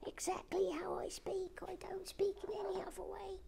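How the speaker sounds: tiny, very high whispered voice; a little figure insisting on her own voice; Little Sue's voice. Retold or imitated. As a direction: squeak it out in a tiny breathy whisper. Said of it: "That's exactly how Little Sue speaks".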